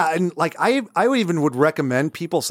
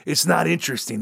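ordinary talk

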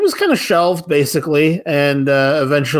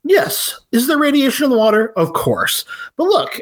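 lightly voiced Martin Landau character; 9 semitones higher than usual. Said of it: "he's like".

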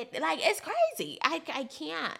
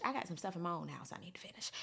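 quick near-whisper, much softer; hushed aside; 6 semitones lower, 10 dB quieter, faster than usual. Real, not acted. Admitting to listeners her own house needs work, hushed. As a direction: drop to a hurried, whispery mumble. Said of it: "And let me hush".

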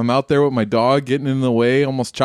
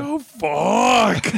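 retold yell; 10 semitones higher than usual. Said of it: "It was like".

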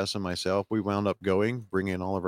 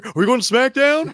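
way up high and loud, thrilled; near-squealing repeat of the question; excited squeal; 9 dB louder than usual. Retold or imitated. Son asking dad at the arena, thrilled high squeal. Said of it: "He was excited".

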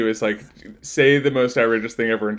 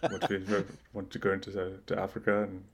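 Musk impression. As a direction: mumble and trail off like Musk. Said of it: "the Musk impression where it's just kind of weird and mumbly".

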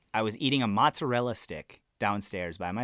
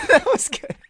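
laughing hard, high and loud; 8 dB louder than usual. Real, not acted. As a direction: crack up while talking.

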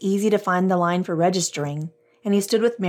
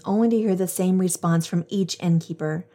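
usual speaking voice

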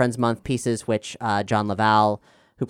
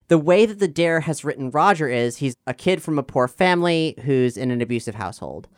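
usual speaking voice

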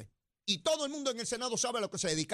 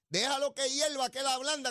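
lightly mimicking a senator's saying; a hint of Tomás Rivera's catchphrase, higher; quoting Tomás Rivera; 6 semitones higher than usual. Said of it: "como dice Tomás Rivera Chat". (citando a un senador con un toque de imitación)

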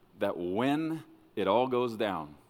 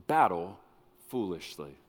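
normal talking voice

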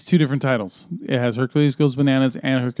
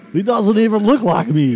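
mock movie line; 6 semitones higher, 5 dB louder than usual.

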